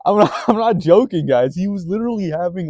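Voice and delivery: ordinary talk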